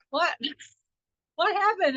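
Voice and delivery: high-pitched mock confusion with a laugh; amused impression of someone caught off guard; imitating bewildered ex-boyfriend. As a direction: play his baffled question, voice jumping up. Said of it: "I saw him kind of go like".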